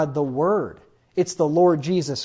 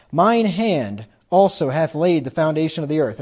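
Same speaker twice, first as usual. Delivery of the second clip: louder, solemn reading of God's words; scripture reading; 5 dB louder than usual. Acted. Preacher reading God's words aloud to the congregation, louder and solemn. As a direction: declaim the verse like the voice of God.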